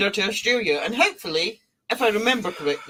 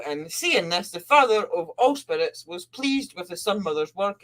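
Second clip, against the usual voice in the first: ordinary talk, nothing marked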